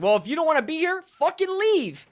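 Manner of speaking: high, hurried mock indignation; mocking fans. Fan imitating loyalists telling critics to leave, mocking. Voices arrogant fans dismissing complainers.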